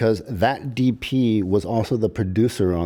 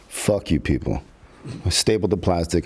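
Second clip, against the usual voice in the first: usual speaking voice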